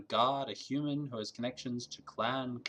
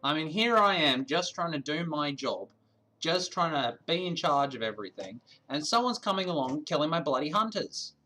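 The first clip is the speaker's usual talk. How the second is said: louder, hammed-up Australian accent; 3 semitones higher, 4 dB louder than usual. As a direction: rant in a broad mock Aussie voice. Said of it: "Harry's not Australian".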